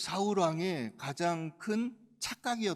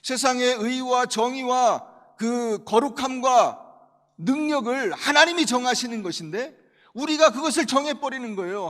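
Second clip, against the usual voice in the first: forceful preaching; 6 semitones higher, 8 dB louder than usual. Real, not acted. Preacher presses his main point.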